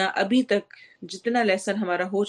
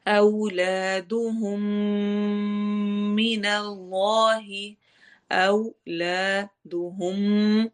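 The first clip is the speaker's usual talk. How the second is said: slow, drawn-out tajweed recitation; slow Quran recitation; much slower than usual. Shown as a demonstration. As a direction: chant each word slowly with the long vowels held. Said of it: "اس کو لمبا کرنا ہے". (آہستہ تجوید کے ساتھ تلاوت)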